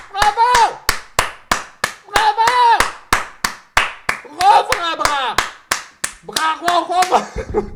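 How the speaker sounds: high cheering cry; mock crowd cheer. Streamer faking a crowd cheering for Adem, high bravos.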